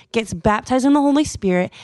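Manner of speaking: ordinary talk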